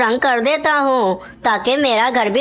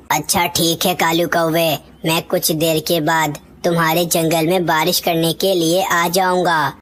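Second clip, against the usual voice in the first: a big rain cloud, pitched much lower; deep cloud voice; 8 semitones lower than usual. Acted. Cloud promising the crow rain later, in a much lower voice. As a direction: speak low and unhurried like a giant cloud.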